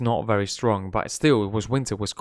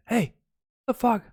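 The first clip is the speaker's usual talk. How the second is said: mock shout; 9 semitones higher than usual. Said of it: "le grité".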